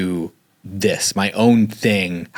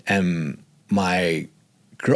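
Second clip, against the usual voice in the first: usual speaking voice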